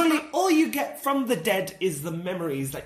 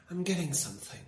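put-on medium; 6 dB quieter than usual. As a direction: feel your way softly into the message. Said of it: "mediums will go like".

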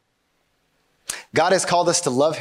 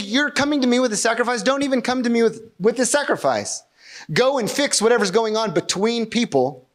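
high-pitched, insistent reproach voiced for God; urgent scolding in a raised pitch; exasperated rebuke; 7 semitones higher than usual. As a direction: send them away to sort it out, pitch raised. Voicing God rebuking the worshipper.

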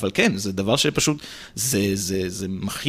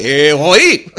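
calling out the name רועי with a South American rolled r; רועי said with a trilled r, loud and high; rolled r teacher call; 3 semitones higher, 9 dB louder, much slower than usual. Retold or imitated. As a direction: call out רועי loudly, rolling the r. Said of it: "זה לא היה רועי, זה היה רועי".

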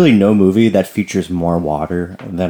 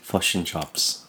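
slow, softer "Fush and chops", U-like vowels for each I; New Zealand accent; 10 dB quieter, much slower than usual. Shown as a demonstration. Practising the vowel swap, slow soft New Zealand accent. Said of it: "replace the I's with U's".

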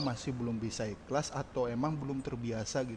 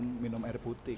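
ordinary talk

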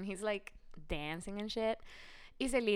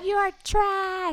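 high, loud, slow insult; hammed-up crowd yell; mock yell; 11 semitones higher, 10 dB louder, much slower than usual. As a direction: bellow it at the guy. Imagined club yelling.

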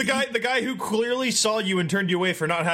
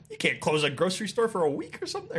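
ordinary delivery

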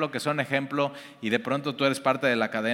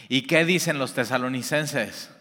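normal talking voice

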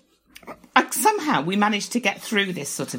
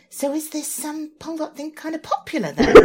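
mimicked young man; 9 semitones higher than usual. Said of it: "he said".

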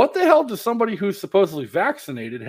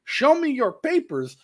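mock Nazi officer; 10 semitones higher, slower than usual. Quoting Nazi film trope.

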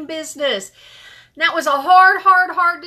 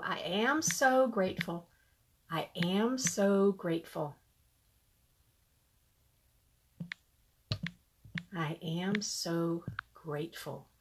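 1 soft mantra; 9 semitones lower, 12 dB quieter than usual. Acted. Leads a repeated gratitude mantra.

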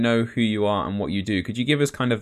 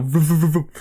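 imitated engine noise; 6 semitones higher, 4 dB louder than usual. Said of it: "she'll be like".

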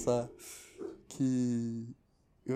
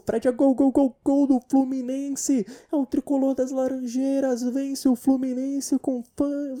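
loud, rising, excited football play-by-play; biased club commentator; 7 semitones higher, 6 dB louder than usual. Retold or imitated. Mocking a biased club commentator, loud goal call. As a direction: call the goal like a partisan radio narrator. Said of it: "ele narra o jogo falando assim".

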